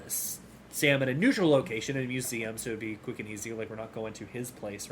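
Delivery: ordinary delivery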